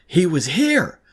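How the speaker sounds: high, loud panicked scream. A narrator's reading. Girl screaming to the teachers that he was here, panicked scream. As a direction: scream it, desperate to be believed. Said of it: "I screamed".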